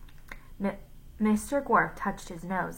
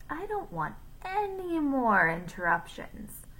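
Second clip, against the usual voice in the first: a stern teacher figure, pitched up; Mister Gorf; 6 semitones higher than usual. A narrator's reading.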